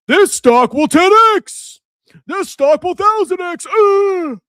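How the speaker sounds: hammed-up grifter. Streamer mocking grifters hyping stocks, high and shouting. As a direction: pump the stock like a scammy guru. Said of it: "I'm gonna grift".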